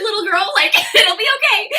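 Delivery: high cooing with laughter breaking in; mock comforting. Hostess comforting scared guest, laughing mock coo.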